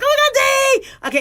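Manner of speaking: very high, drawn-out excited squeal. Shown as a demonstration. Doctor showing how she talks when excited, high voice. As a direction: demonstrate over-excited high-pitched talk. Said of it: "when I get excited, I talk really high".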